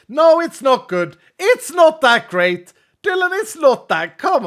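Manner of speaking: high, loud, slow protest; exasperated shouting. Pretend. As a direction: yell each objection, drawn out and high. Mock outburst about the joke.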